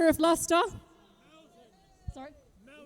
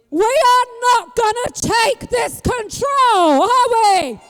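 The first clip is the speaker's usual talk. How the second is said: higher, louder and slower, drawn out to the crowd; rallying shout; 6 semitones higher, 4 dB louder, slower than usual. Shown as a demonstration. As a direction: build it into a rallying cry. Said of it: "repeat of line 89".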